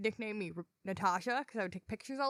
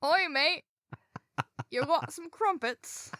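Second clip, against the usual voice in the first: higher, hammed-up English accent; mock English accent; 5 semitones higher, slower than usual. Proving it is English enough, hammed-up English accent. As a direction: lay on a silly English accent. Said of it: "is that English enough for you?".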